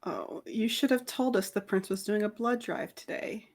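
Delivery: ordinary talk, nothing marked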